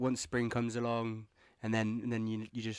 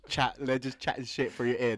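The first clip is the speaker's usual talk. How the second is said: ordinary talk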